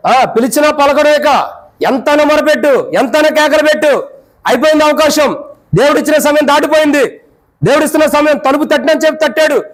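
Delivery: pleading sermon at full force; fervent preaching. Acted. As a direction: preach it out with urgency.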